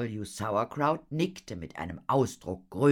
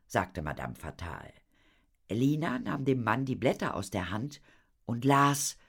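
plain reading voice

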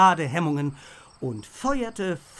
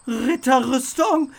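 slow, higher, louder disbelieving croak; stunned croak; 5 semitones higher, 5 dB louder, slower than usual. A narrator's reading. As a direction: gasp it out in disbelief, slowly. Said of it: "krächzte Theo fassungslos".

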